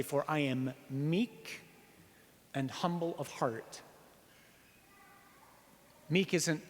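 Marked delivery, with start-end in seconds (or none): 0.0-3.8 Jesus quoted. Retold or imitated. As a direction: let it sink in slowly and gently. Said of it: "he goes on to say".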